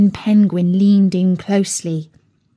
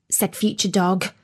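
ordinary delivery, nothing marked

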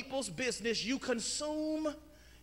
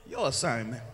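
normal talking voice

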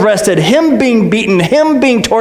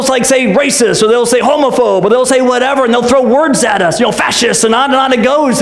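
ordinary talk, nothing marked

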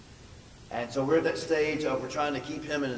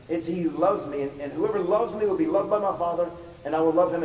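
louder, higher preaching of Jesus' words; preaching scripture; 3 semitones higher, 4 dB louder than usual. Acted. Pastor to congregation quoting Jesus, preached loud. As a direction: proclaim the scripture with full force.

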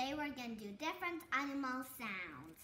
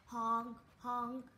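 honking goose call; 5 semitones lower than usual. Shown as a demonstration. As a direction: honk like a goose.

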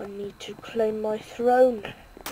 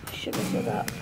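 whisper; 8 dB quieter than usual. Real, not acted. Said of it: "unvoiced regretful aside".

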